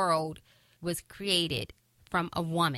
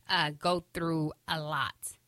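ordinary delivery

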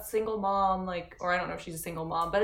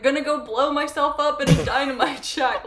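laughing; 5 semitones higher, 6 dB louder than usual. Real, not acted. Joking quote, laughing.

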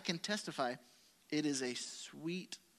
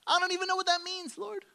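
fast, much higher, louder baffled cry; bewildered outburst; 7 dB louder, faster than usual. Retold or imitated. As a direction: blurt it out, completely lost. Bewildered reply to God retold.

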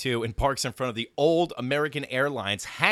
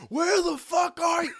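much higher, louder angry shout; furious yell; 5 dB louder than usual. Retold or imitated. Tony yelling at his lost friend over the phone. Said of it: "I've never heard him like this".

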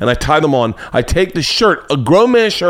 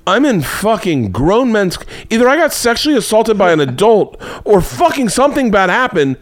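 high-pitched comic indignation; worked-up imagined rant, voice climbing high; exasperated outburst; 7 semitones higher than usual. Retold or imitated. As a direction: protest as if it's blindingly obvious.

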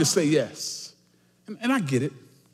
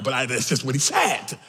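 usual speaking voice

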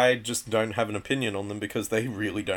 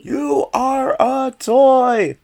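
high, loud, slow frantic cartoon yell; Woody yelling; 10 dB louder, much slower than usual. Cohost joins in yelling a Woody line. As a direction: scream it like a toy losing his mind. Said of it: "his Woody voice, where he's yelling stuff".

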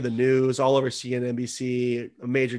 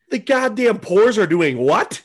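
higher, louder and slow, mock indignant; mock rich outrage; 7 semitones higher, 7 dB louder, slower than usual. Friend mocks billionaires outraged at small traders, slow and loud. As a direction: drag it out like an offended billionaire.

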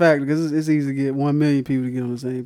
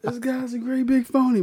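laughing; 10 semitones higher than usual. Real, not acted. Mocking a bad accent, laughing.

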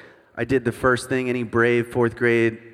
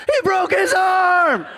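high, slow, loud panicked scream; screaming bloody murder; 5 dB louder, slower than usual. Retold or imitated. Mom screaming over her son's broken arm, high and loud. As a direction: shriek it out in full alarm. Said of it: "she goes".

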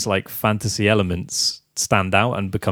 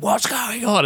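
mock villain outburst; 13 semitones higher, slower than usual. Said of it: "and it's like".

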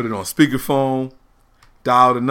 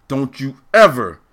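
stern father warning; 4 semitones higher, 5 dB louder than usual. Retold or imitated. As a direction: lay down the law like a strict dad. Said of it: "most serious daddy face".

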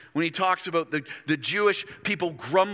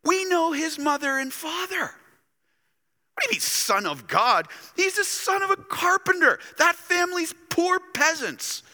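much higher, mocking disbelief; scoffing townsfolk; 12 semitones higher than usual. Preacher voicing synagogue crowd mocking Jesus, scornful and high. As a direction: sneer at the upstart from Galilee.

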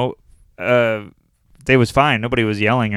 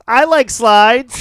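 put-on high, slow little-girl voice; 10 semitones higher, 7 dB louder, slower than usual. Comic retelling a little girl heckling his show, high and slow. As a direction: imitate a small girl's sweet reply. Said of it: "this little girl goes".

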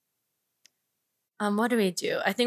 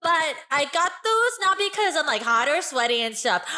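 put-on five-year-old voice; 2 semitones higher than usual. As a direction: play a chirpy child clown. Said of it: "'Cause she sounds like she's five".

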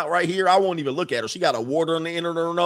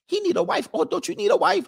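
high-pitched mock female voices; imitated cleaners. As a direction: squeak it like nosy cleaning ladies. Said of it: "They like".

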